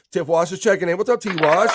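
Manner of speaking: loud, high shout-out; hype greeting. Acted. Host welcoming Tiff to the stream, loud and high. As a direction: call her name out big.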